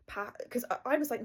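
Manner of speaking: ordinary talk, nothing marked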